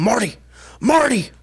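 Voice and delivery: high-pitched mock yell; imitated yelling. Retelling Cody yelling at Marty in the ring, mock yell. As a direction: call out the name, pitch leaping up. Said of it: "Cody just kept yelling".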